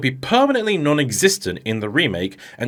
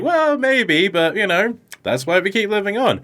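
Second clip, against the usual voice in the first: higher, breezy and cocky; cocky Sonic; 8 semitones higher than usual. Retold or imitated. Sonic answering the villain, cocky and carefree. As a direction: toss it off like a smug hero. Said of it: "Sonic is cocky about it".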